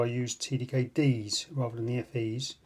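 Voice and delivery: ordinary delivery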